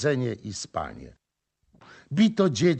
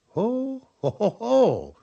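booming ho-ho laugh, much higher; hearty laugh; 11 semitones higher, slower than usual. Acted. Rybak śmieje się z chłopa. (rubaszny śmiech wioślarza)

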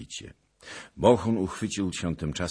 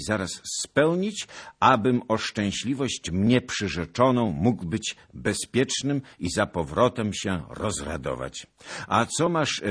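raised, loud formal letter voice; pompous letter reading; 6 semitones higher, 6 dB louder than usual. Acted. Zagloba reading the letter aloud, pompous and loud. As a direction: declaim the letter grandly.

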